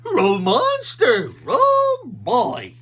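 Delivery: very loud, slow shout; dog shouting. A narrator's reading. Scooby yelling at the monster, loud and slow. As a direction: howl it at the monster.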